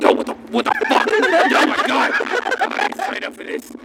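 high, breathless freak-out; a terrified creature yelping; panicked monster. Acted. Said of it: "the thing behind you's like".

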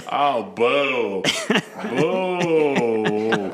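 put-on low, drawn-out boo in a Queens accent; haunt lazily in mock New York; hammed-up Queens woman as a ghost. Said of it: "She'd be like".

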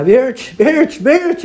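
high-pitched imitation of a tearful cry; llanto imitado. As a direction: call out as if weeping over someone. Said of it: "hermana Marianita estaba llorando". (imitando un llamado desesperado entre llanto)

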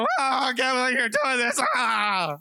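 high, put-upon whiny complaint; lightly hammed-up offended partner; mock fit. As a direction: act out a petty tantrum. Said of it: "we throw a fit".

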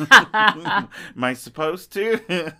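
laughing question. Retold or imitated. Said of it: "I'm sitting there going".

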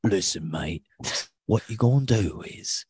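crude British hard-man accent; mock-menacing British accent. Shown as a demonstration. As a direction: threaten like a British comedy thug. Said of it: "I'm a big Brit, uh, com guy".

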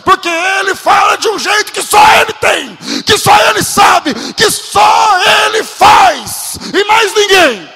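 building from loud to a roaring high shout; full-force preaching. Acted. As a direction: preach it up until you're shouting at the top.

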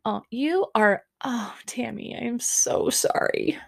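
high, soft, put-on sympathy; mock pity. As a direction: coo fake condolences, trailing into near-whisper. Host mocking Tammy's tearful birthday complaint.

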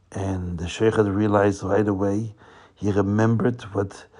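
ordinary delivery, nothing marked

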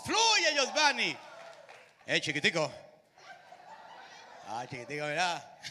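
put-on light Cuban accent, loud at first; lightly voiced Cuban street talk; a hint of a Havana accent. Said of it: "los cubanos".

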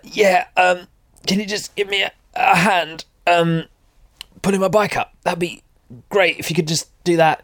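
imitating a hurt rider, high and halting; high, hesitant voice of someone just injured. Crashed rider asking a stranger for a hand, injured voice. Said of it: "I was incredibly injured at that point".

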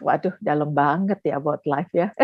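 chuckling through the words; laughing. Real, not acted. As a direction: laugh while you say it. Joking the topic is deep.

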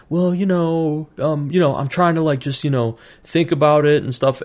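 high, hesitant, hammed-up whiny student; mock excuse-maker. Student dodges his question about pursuing goals, high mock whine. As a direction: stall and dodge like a lazy student.

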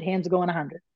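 ordinary talk, nothing marked

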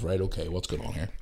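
low, very soft aside; talking to oneself. Retold or imitated. Claimant to himself amid the screaming, in an undertone. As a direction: think aloud under your breath. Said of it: "I'm going to myself".

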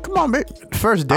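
high whiny come on babe; a hint of a needy date; mock pleading woman. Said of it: "she like".

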